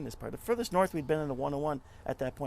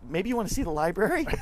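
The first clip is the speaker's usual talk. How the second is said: pointed suggestion; 6 semitones higher, 7 dB louder than usual. Retold or imitated. Wife pointedly redirects girl-watching son.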